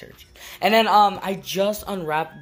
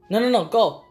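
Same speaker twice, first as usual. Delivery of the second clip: shooing the dog; 6 semitones higher than usual. Real, not acted. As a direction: shoo the dog away from the hot oven. Shooing dog away from oven.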